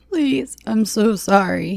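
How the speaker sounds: high, crying apology; tearful begging for mercy. Acted. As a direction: weep out the apology. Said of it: "he cried".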